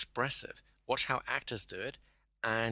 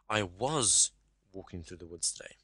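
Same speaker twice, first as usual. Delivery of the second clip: "was" stressed and held, then a pause and a soft remainder; 2 semitones lower, 3 dB quieter than usual. Shown as a demonstration. Coach showing how emphasis changes the meaning, stress on "was". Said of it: "you stress it differently".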